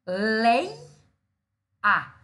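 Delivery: lei, a as two loud, separate beats; syllable by syllable. Shown as a demonstration. Teacher splitting geleia for students, loud detached lei, a. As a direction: punch lei, pause, then a. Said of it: "Eu separo o lei".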